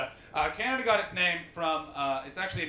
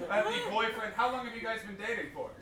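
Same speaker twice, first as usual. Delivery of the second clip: usual speaking voice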